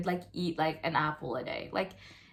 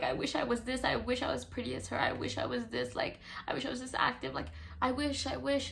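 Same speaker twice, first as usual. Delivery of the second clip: higher and softer, wistful self-talk; envious inner voice; 6 semitones higher, 4 dB quieter, faster than usual. Retold or imitated. Voicing her own envy at social media, high and soft. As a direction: sigh it out like you long to be her. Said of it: "I look at it and I'm like".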